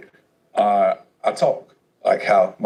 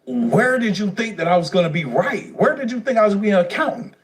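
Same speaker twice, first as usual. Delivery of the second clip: much higher, fast, outraged questions; furious retort; 11 semitones higher than usual. Retold or imitated. Son shouting back at his crying mother, outraged. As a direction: fling every question back at her.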